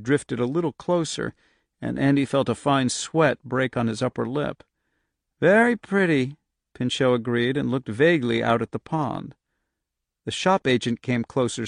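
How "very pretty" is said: higher, dreamy, faraway echo; dream voice. A narrator's reading. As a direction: echo it back as if entranced. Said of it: "in that same dreamy voice".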